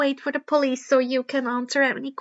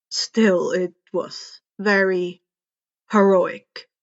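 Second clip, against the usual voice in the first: lower, slow and drawn out; slow knowing compliment from the mentor; pointed praise; 5 semitones lower, 3 dB louder, slower than usual. A narrator's reading. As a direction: lean on it as a hint. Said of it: "Eraser eyed Karma carefully".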